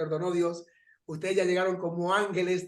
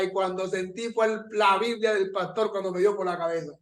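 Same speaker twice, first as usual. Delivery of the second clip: ordinary talk, nothing marked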